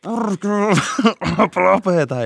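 retold yelp of pain; pained yell. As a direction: cry out as the door crushes your finger. Finger slammed in door.

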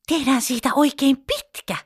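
put-on a bright eager little girl's request; excited. A narrator's reading. Pippa eagerly asking dad for a long train track, excited. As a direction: pitch it up, eager to build. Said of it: "Pippa selitti innoissaan".

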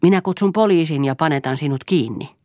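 angry wife threatening to call police. Acted. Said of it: "oli vihainen".

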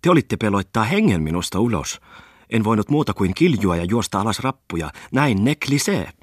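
a lady's high, put-on foreign lilt on broken Finnish; foreign-accented Finnish. Merchant's wife gushing to the guests in her foreign accent. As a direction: chatter on in a light Swedish-tinged accent. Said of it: "epäsuomalaisella äänensoinnulla".

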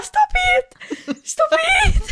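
high, laughing mock shout at the coat; mock shrieking. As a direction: scream at the hanger in playful outrage. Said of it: "Man bara". (skrattande låtsasskrik)

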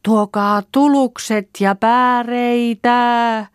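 very high, loud, long-held holler. A narrator's reading. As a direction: stretch every word into a distant call. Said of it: "Koitetaan lujemmasti".

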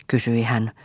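ordinary delivery, nothing marked

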